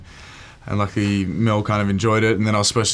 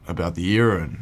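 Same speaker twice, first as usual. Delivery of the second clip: ordinary talk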